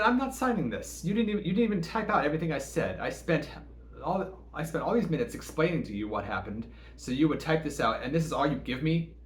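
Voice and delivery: high, fast indignant complaint; angry protest. Retold or imitated. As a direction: push back hard, voice high and fast. Angry refusal to sign report.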